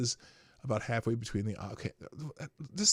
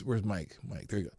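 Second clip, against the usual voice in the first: normal talking voice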